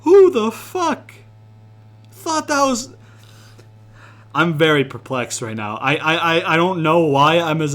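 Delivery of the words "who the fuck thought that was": high, slow, louder cry of disbelief; shocked outrage. Real, not acted. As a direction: squeal it out in outrage. Outraged at move name.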